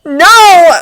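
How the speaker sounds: loud, high, desperate cry; anguished shout. A narrator's reading. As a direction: cry out pleading. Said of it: "Bucky desperately thinks".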